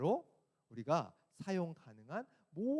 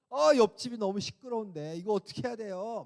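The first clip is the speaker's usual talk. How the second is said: mock complaint; 6 semitones higher, 8 dB louder than usual. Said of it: "주인에게 전화를 해서 막 따졌어요".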